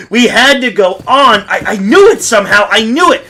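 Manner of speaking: frantic insistence. Acted. Desperate flight, panicked insistence.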